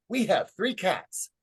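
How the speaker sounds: put-on high, drawn-out little boy's voice; a small child piping up off topic. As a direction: announce it like a proud kindergartner, slowly. Voicing little boy's off-topic answer.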